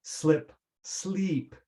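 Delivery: short slip then long sleep; vowel length contrast. Shown as a demonstration. Teacher drilling learners on short and long vowels. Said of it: "Vowel length".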